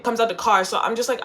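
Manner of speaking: normal talking voice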